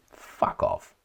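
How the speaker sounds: breathy whispered dismissal; a disgusted whisper, barely voiced; whispered fuck off. Real, not acted. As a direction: hiss it under your breath. Dismissive aside to viewers.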